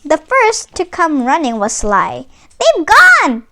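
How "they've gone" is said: high, loud alarmed cry; Tweed fakes alarm for Sly; cried out. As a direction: call it out as if in shock. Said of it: "cried Tweed".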